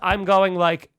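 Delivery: ordinary talk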